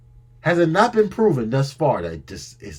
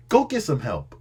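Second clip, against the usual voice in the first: ordinary talk, nothing marked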